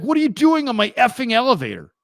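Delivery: high, loud indignant yell; angry Howard. Retold or imitated. Howard yelling at the stranger on his private elevator, loud and high. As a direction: demand to know why he's on your elevator. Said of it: "He's like".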